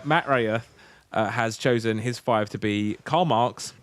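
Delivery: usual speaking voice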